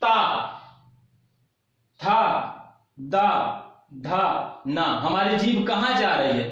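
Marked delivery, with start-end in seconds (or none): 0.0-4.8 ता, था, दा, धा, ना each held apart by a long pause; syllable drill. Shown as a demonstration. Teacher shows where the tongue touches, syllable drill of ता, था, दा, धा, ना. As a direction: sound out each dental syllable alone, ता through ना. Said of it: "अब इसको देखिए".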